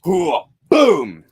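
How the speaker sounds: loud high war whoop; mock battle cry. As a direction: whoop like barging in ready to fight. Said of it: "no, I'm joking".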